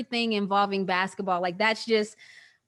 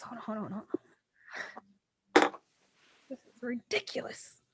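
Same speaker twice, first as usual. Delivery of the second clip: softer, tearful, regrouping; 7 dB quieter, faster than usual. Real, not acted. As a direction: talk through the tears, softly. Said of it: "I'm getting soft in my older age".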